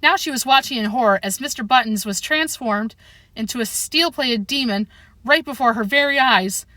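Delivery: ordinary delivery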